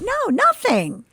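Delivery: high, slow squeaky "no"; flustered denial. Retold or imitated. Student hiding her faith from roommate, flustered high. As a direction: deny it too brightly.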